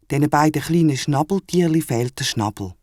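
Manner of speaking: ordinary delivery, nothing marked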